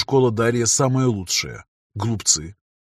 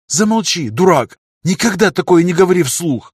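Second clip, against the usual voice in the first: loud, high, frightened scolding; 7 semitones higher, 7 dB louder than usual. Acted. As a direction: snap at him out of fear. Frightened lover scolds him.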